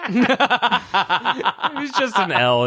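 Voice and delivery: slow, laughing through words. Real, not acted. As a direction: laugh while saying it. Laughing at friend's mistake.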